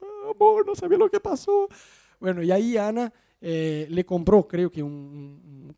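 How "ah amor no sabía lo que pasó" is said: much higher put-on whiny voice; mock voice. Husband mocking himself asking his wife, higher falsetto. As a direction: squeak it out in a silly falsetto.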